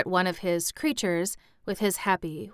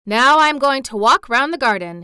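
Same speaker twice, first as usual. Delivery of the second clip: proud ringing announcement, louder and higher; a boy's triumphant declaration; announcing; 5 semitones higher, 10 dB louder than usual. A narrator's reading. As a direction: declare it to everyone. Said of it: "he announced".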